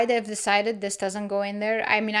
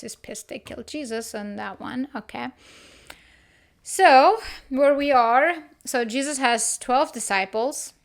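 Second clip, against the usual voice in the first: ordinary talk, nothing marked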